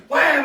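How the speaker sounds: high loud whack sound; shouted wham. Retold or imitated. Imitating Pat's pitchfork strike, a loud wham. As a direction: bang it out like a strike.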